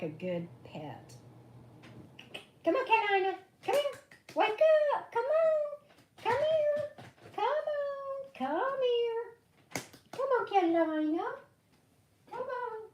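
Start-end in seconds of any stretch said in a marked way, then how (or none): 2.6-12.9 very high sing-song calls to a dog; high pet-calling voice. Real, not acted. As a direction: coax the dog up, high and sweet. Coaxing her dog Carolina.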